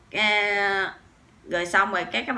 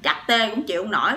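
normal talking voice

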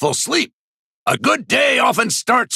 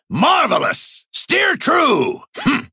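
usual speaking voice

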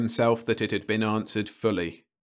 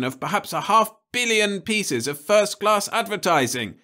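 higher, louder, fast tirade of outrage; indignant official; 10 semitones higher, 5 dB louder than usual. Acted. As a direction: pile up the damage on the boy. Crumbine lectures the culprit.

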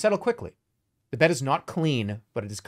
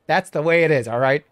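put-on gruff old man, louder; 2 semitones higher, 8 dB louder than usual. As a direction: bark it like a grumpy old villager. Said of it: "Two voice [name]".

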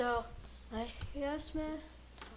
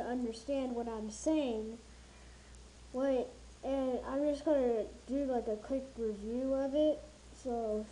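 muffled behind respirator; through a gas mask. Real, not acted. Seen 3: Respirator mask with twin filters covers mouth throughout the labelled words.

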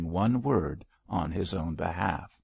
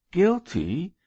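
high, louder, incredulous echo; shocked protest; 11 semitones higher, 5 dB louder than usual. A narrator's reading. Chaplain called guilty by his bishop, shocked. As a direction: repeat the accusation in high, loud disbelief.

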